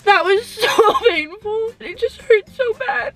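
slow, high tearful wail; pained crying, pitch rising. Real, not acted. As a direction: drag the words out through real sobs. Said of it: "I am crying very intensely".

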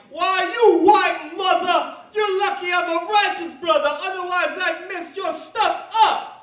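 loud, high, drawn-out mock tirade; angry stranger. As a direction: imitate an outraged man threatening loudly. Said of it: "and he was totally like".

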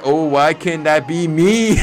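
mock lament. Real, not acted. Envying the wrestler in the video, laughing mock lament. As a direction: wail it jokingly, then laugh.

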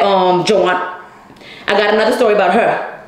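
ordinary talk, nothing marked

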